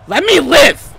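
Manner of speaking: loud, high plea; exasperated yell. Real, not acted. Gamer answering his commenters, exasperated yell. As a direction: shout it at your critics.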